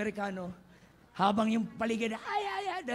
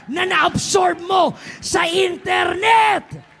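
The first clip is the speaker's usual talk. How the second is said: loud, high, drawn-out preaching shout; full-force preaching; 8 semitones higher, 12 dB louder, slower than usual. Acted. Preacher shouting to the congregation about truth, full force. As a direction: thunder it from the pulpit.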